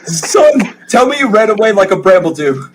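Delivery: high, loud blubbering father; weepy overjoyed dad. Acted. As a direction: sob with joy at your son's return. GM plays the father.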